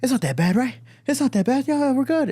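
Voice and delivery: high, anxious self-reassurance; strained high pitch, climbing as he reassures; paranoid dad. Retold or imitated. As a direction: imitate dad nervously convincing himself. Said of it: "walking around paranoid as shit".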